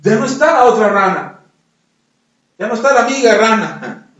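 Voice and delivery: ordinary talk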